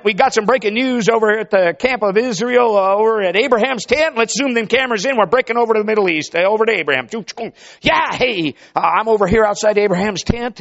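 excited live breaking-news reporter; street news interviewer. Acted. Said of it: "man on the street interviewer that day".

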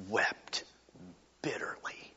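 whispered, grieving hush; sorrowful whisper. Acted. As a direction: breathe the words out almost silently. Whispering the word for weeping.

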